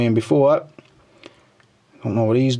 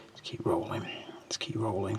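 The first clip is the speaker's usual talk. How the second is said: whisper; 4 semitones lower, 17 dB quieter than usual. Real, not acted. Murmuring to himself while scrolling.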